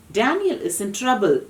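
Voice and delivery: ordinary delivery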